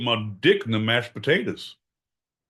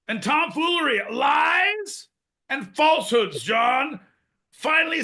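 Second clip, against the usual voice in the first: high excited rant; 13 semitones higher than usual. Acted. Praising John for finally speaking the truth, high ranting voice. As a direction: rant in high-pitched vindication.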